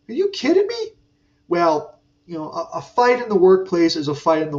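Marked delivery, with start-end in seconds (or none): 0.1-0.9 high, indignant are you kidding me; can you believe this, pitched up; incredulous outrage. Retold or imitated. Telling the HR guy off, high indignant disbelief. Complaining to HR about coworker.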